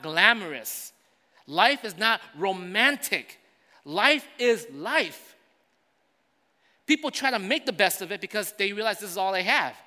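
high, loud, driving preacher voice; loud sermon delivery building through the list; full-force preaching. Acted. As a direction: preach it out to the whole congregation. Preaching climax on life.

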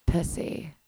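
breathy unvoiced whisper; sexy whisper. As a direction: whisper it seductively. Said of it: "say it in, like, a sexy way".

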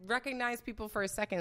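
normal talking voice

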